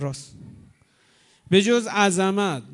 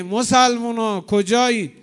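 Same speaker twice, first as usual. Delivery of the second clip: loud, slow cry for help; calling out; 3 semitones higher, 6 dB louder than usual. Acted. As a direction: call out to the crowd.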